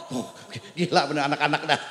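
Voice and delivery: ordinary delivery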